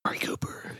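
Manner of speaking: hushed whisper. Real, not acted. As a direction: breathe the name out. Whispers card name.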